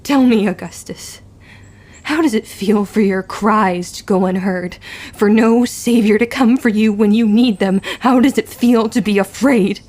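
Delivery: loud, menacing taunt; cold taunting. Acted. Tilla taunts the bully Augustus, loud and menacing. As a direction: sneer down at a cowering bully.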